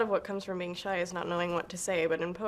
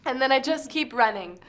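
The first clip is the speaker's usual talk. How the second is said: slam poem, fading out; 2 semitones higher, 4 dB louder than usual. Acted. Poet who just keeps running, spoken-word delivery fading away. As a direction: let the listed words die away.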